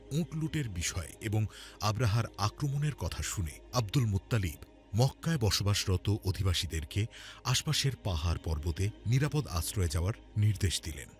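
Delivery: usual speaking voice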